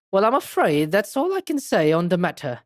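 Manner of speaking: lilting, theatrical character voice; expounding game detective. Acted. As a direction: expound it with big swoops of pitch.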